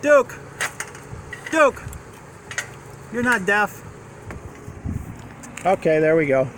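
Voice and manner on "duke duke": calling out. Real, not acted. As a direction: call the dog from far away. Calling dog across yard.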